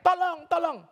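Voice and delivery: high, loud panicked cry of tolong; mock scream for help. Man in monkey suit falls by lion, screams in panic. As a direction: shriek it like a terrified man. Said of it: "dia teriak".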